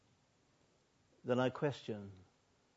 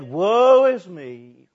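loud, very slow lament; anguished cry; 4 semitones higher, 15 dB louder, much slower than usual. Acted. Isaiah crying out before God, loud slow lament. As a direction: wail it out in despair. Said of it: "Then I said".

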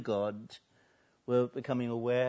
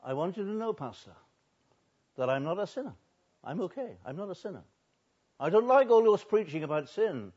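higher, lightly voiced huffy churchgoer; indignant woman; 5 semitones higher, 4 dB louder than usual. Woman scolding the pastor about his preaching, mock indignant. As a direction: sniff at the pastor in offended protest. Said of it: "she told me".

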